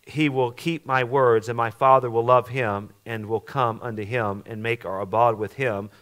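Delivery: preacher reading scripture at full force; preached scripture reading. Acted. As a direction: proclaim the verses to the congregation.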